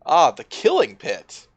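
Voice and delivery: loud, slow, savoring each word; dramatic exclamation. Real, not acted. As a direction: belt it out with mock dread. Player reacts to deadly trap.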